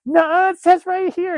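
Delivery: put-on very high, loud, drawn-out child's protest; imitated arguing kid. Son fights dad over the character's name, mimicked indignant kid voice. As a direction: squeal it like a stubborn little boy. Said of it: "And he fights me".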